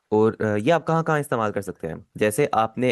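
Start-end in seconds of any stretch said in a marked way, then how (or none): none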